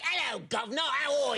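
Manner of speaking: a clumsy American doing British Cockney; bad British accent. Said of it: "I'd be like".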